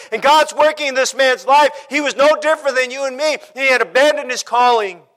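driving sermon delivery; impassioned preaching. Acted. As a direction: preach it with rising urgency. Preaches at full force.

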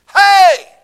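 loud, high shouting dare to the devil; challenging shout. Retold or imitated. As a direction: call the devil out, bellowing. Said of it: "I went around like this".

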